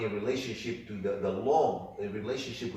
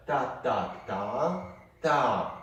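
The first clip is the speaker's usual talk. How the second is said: ta ta ta ta clapped out in rhythm; rhythmic syllables, short short long longer; rhythm demo; 4 semitones higher, 4 dB louder, much slower than usual. Shown as a demonstration. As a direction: sound out the rhythm for the student. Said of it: "that's the formula that we've been practicing".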